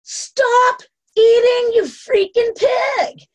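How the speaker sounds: loud, high, drawn-out yell; mock-furious shout, each word stretched. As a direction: bellow it slowly at full voice, imitating a public outburst. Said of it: "look down at the chair and yell".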